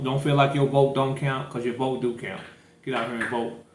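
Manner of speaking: usual speaking voice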